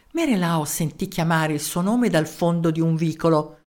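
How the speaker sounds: usual speaking voice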